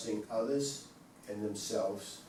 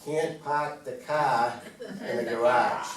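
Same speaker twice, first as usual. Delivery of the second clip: Boston accent; 4 dB louder than usual. Shown as a demonstration. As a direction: drop the r's like a Bostonian. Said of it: "I'm gonna use my Boston accent here".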